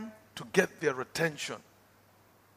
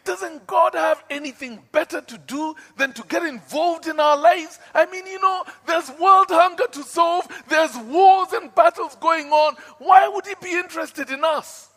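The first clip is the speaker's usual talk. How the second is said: loud, high, indignant questioning of God; preacher reliving his defiant shout; retold angry outburst; 12 semitones higher, 11 dB louder than usual. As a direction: fling the questions at heaven. Retells angry challenge to God.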